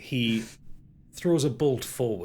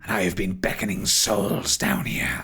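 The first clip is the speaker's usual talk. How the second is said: whispery, brooding spirit voice; ghostly villain; 3 semitones lower than usual. Acted. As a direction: tell the grim history in a menacing half-whisper. Game master voices Derethor.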